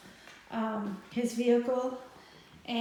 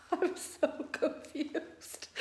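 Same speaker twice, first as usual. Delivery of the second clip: whispered through giggles, slow; confused laughing whisper; 4 semitones higher, 5 dB quieter, much slower than usual. Retold or imitated. Telling viewers about the locksmith, in a slow giggling whisper. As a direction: breathe it out half-laughing, baffled. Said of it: "I'm like".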